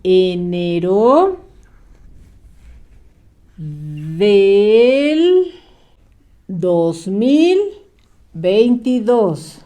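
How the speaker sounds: "Enero" stretched very slowly; slow dictation. Shown as a demonstration. Teacher dictates the month slowly for the child to write. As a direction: spell out "Enero" syllable by syllable. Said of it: "una palabrita muy cortita".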